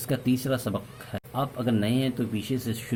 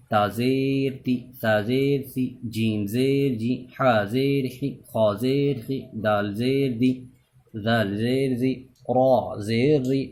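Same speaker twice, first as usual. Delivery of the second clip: recitation drill; 2 semitones higher than usual. Shown as a demonstration. Leads the zer spelling drill.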